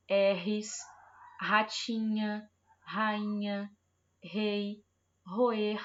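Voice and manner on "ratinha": ratinha slowly, the R as a throaty h sound; strong R, slow. Shown as a demonstration. Teacher drawing out ratinha for the class, slowly. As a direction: stretch out ratinha with a hard throat R. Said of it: "o R tá saindo com som forte".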